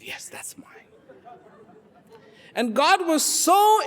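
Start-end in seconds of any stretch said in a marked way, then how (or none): 0.0-0.9 barely audible, humble denial; meek near-whisper. Acted. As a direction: answer softly, almost in a whisper. Woodcutter humbly claims old axe.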